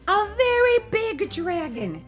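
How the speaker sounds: very high, loud insistence; insistent boy. A narrator's reading. Billy insisting to his mother the dragon is real, loud and emphatic. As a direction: drive each word home, high and loud. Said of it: "Billy insisted".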